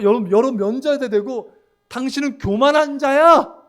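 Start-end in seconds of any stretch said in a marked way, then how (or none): 1.9-3.5 slow, high, loud mock accusation; face-to-face accusation. Someone accuses a believer of pride, loud and confrontational. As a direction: accuse them loudly to their face, rising in pitch.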